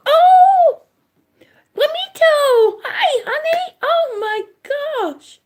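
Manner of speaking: high, delighted welcome to a favourite viewer; light up as a friend arrives; excited welcome. Real, not acted.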